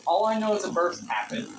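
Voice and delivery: loud, excited report. Real, not acted. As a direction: call it out loudly. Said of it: "Why are you so loud?".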